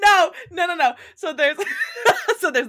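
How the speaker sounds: high, loud laughing no's; giggly denial, high and loud; laughing protest. Real, not acted. Reacting to wrong title.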